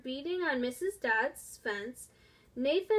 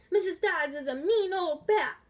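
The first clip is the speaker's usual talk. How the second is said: yelling; 5 semitones higher, 5 dB louder than usual. A narrator's reading. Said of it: "They wanted to yell".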